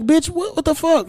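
high squeaky disbelief; high incredulous what the fuck. Retold or imitated. Mocking disbelief at dating profiles.

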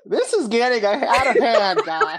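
loud, high, through real laughter; laughing. Real, not acted. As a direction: protest while cracking up. Trying to speak through group laughter.

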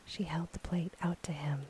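usual speaking voice

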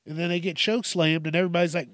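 ordinary talk, nothing marked

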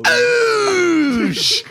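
high piercing shout of triumph; 1 shouted exclamation. Pretend. Prankster yelling while pranking his friend. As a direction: yelp it out as you strike. Mock wrestling fester attack.

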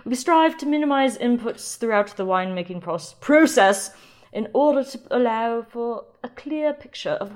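reading the winery's website in a mock British accent. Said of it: "I'll stop the British accent".

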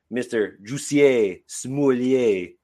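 drawn-out, hammed-up Italian-style name; exaggerated Italian lilt, very slow; mock Italian accent. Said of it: "that was more Italian".

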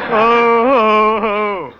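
high eerie cackling laugh; spooky cackle. Acted. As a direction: cackle like a ghoul. Ghoulish sign-off laugh.

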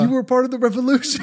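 high-pitched, hurt mock protest; betrayed protest. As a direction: plead in disbelief with friends who turned on you. Pleading with friends who betrayed him.

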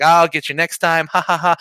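hearty pretend laugh at the end; acting out a winner's good-natured ribbing; jovial retold farewell. Acts out gloating friendly winner.

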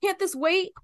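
exasperated at the constant calls; annoyed outburst. Retold or imitated. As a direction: bark it like an interrupted busy person. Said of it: "I was like".

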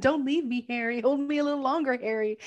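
Harry mimicking Sally begging him to stay, high and whiny; mock pleading Sally.

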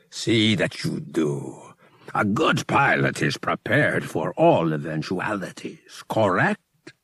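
a powerful priest granting a favor, unhurried. Acted. High Priest instructs Han.

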